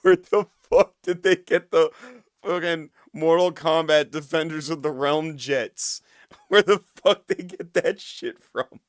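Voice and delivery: real incredulous outburst breaking into laughter; laughing disbelief. Real, not acted. Cracking up in disbelief at the clip.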